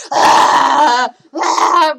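loud mock toddler war cry; toddler battle yell. Toddler punching mom, loud playful yell. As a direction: yell like a two-year-old charging in.

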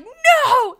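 high, loud stubborn shout; defiant child shouting back, loud high no; toddler yelling no. Retold or imitated.

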